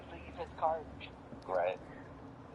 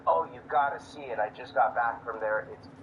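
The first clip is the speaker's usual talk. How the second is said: loud, low and showy to be overheard; a loud, lower staged brag about the place; loud announcement; 8 semitones lower than usual. Acted. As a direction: talk it up loudly for strangers to hear. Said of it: "I'll loudly say".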